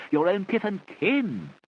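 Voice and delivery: slow, high, drawn-out plea; mock pleading. Children plead with the pretend Uncle G, mock earnest. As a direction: ham up an over-earnest plea. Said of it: "plead with her and say".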